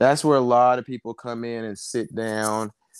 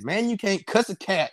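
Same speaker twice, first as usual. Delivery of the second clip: mock exasperated catchphrase; 9 semitones higher than usual. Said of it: "I drop a pan".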